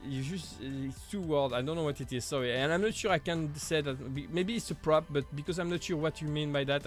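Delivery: normal talking voice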